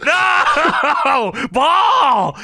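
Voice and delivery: hammed-up high, loud wail; Kane. Wrestler sees Paul thrown downstairs, hammed-up anguished shout. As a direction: cry out in drawn-out mock anguish. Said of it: "And Kane says".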